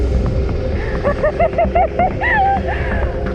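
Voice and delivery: breathless laugh of relief; giddy relieved laughter; nervous high laugh. Real, not acted. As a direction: laugh off the fright. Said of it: "That was really scary".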